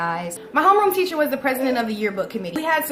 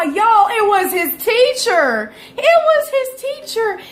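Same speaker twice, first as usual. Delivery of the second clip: higher, slow disbelief; higher, slow incredulous reveal; 8 semitones higher, 3 dB louder, slower than usual. Real, not acted. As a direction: stretch out the punchline. Incredulous punchline about his teacher.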